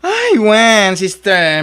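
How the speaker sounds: excited greeting. Acted. Greets neighbour loudly.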